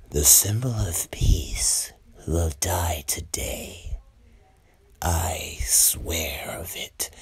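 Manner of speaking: slow, whispery villain voice; sinister drawn-out whisper; menacing villain. Retold or imitated. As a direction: whisper it slowly as a dark threat. Villain Shigaraki announces doom.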